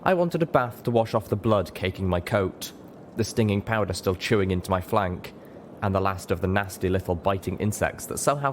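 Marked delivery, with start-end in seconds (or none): none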